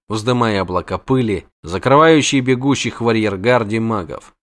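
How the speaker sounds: usual speaking voice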